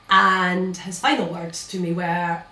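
normal talking voice

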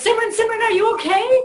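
put-on high, anxious calling of the child's name; worried teacher. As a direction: rush over to check the hurt child. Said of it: "she says".

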